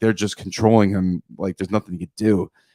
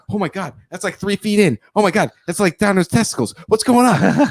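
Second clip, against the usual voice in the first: high-pitched appalled outcry, getting louder; mock horror; 10 semitones higher, 6 dB louder than usual. Retelling a gross movie scene as a horrified viewer, mock horror. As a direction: build to a loud, high, freaked-out squeal.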